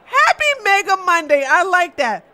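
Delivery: ordinary delivery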